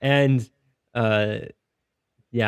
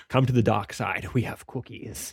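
dark side villain; 4 dB quieter than usual. Acted. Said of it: "Kind of thing, right?".

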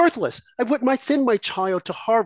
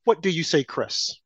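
ordinary talk

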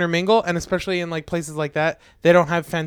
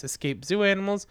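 ordinary talk

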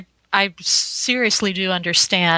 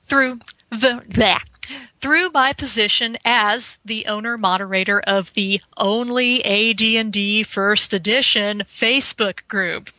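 normal talking voice

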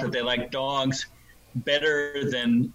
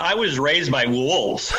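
laughing joke; 4 semitones higher, 6 dB louder, slower than usual. Real, not acted. Joking answer about childhood.

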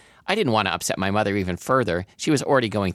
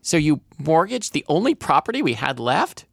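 higher, incredulous question; voice rising; 8 semitones higher than usual. A narrator's reading. Daughter confronting mother over the mortgage, voice rising. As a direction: let the pitch climb as you accuse. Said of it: "My voice rose".

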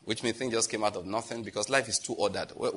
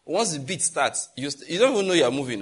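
ordinary talk

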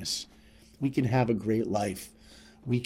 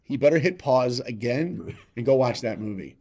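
ordinary delivery

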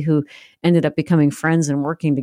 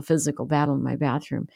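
normal talking voice

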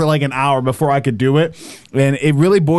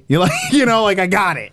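ordinary talk

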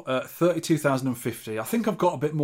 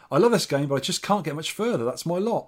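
usual speaking voice

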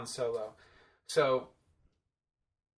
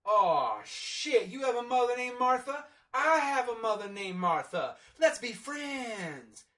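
higher, overjoyed hammed-up Batman, slowing on the last words; mock Bruce epiphany; 8 semitones higher, 3 dB louder, slower than usual. Reviewer mocking Batman befriending Superman, hammed-up delight. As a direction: gush it like an instant best friend. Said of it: "And that's when Bruce is like".

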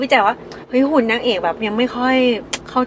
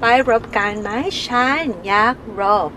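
foreigner speaking Thai; 2 semitones higher, much slower than usual. Retold or imitated. Imitating a Western actor's Thai, mock farang accent. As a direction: stumble through Thai like a Westerner. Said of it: "ฝรั่งพยายามพูดภาษาไทย".

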